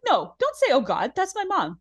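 high-pitched mock scolding; retold scolding. [name] defending her mom to Raquel, high mock scolding.

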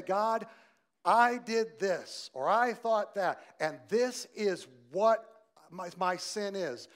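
pleading confession. Pretend. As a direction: pour out a confession to God. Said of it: "When you say".

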